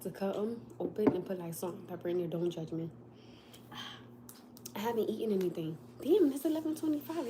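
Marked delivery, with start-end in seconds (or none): 6.0-7.3 higher, startled realisation; jolted by the time on the clock; surprised. Real, not acted. Noticing the late hour while hungry, surprised.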